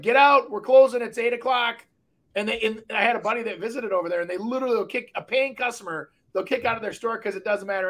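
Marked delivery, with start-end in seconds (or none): 0.0-1.9 mock shout. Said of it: "all the T-shirt shops are like".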